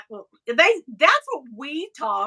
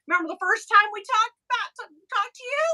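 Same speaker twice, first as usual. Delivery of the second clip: much higher, taunting sing-song; mock gloating; 9 semitones higher than usual. Host taunting a rude star on his way back, high mocking voice. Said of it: "And I'm like".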